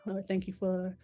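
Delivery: soft, unhurried prayer voice; quiet heartfelt prayer, fading at the end; closing prayer. Acted. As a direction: pray sincerely for the listeners.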